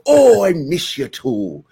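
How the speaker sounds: light Irish lilt; lightly Irish priest. Retold or imitated. Godfather priest phoning [name], light Irish lilt. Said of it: "he'll call me up and go".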